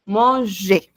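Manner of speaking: Manger ending in a plain é, no r sound; silent final r. Shown as a demonstration. Teacher models Manger for the class, final r silent. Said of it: "Cuando es E R, solamente E".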